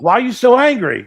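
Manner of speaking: loud, high-pitched startled question; hammed-up sitcom incredulity; mock exclamation. People confront Uncle Leo about his eyebrows, mock exclamation. Acts out fake-eyebrow comedy scene.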